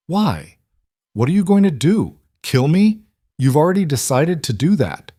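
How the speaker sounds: rising, defiant challenge; defiant anger. Acted. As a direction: throw it back at them, furious. Defiant wife faces accusers.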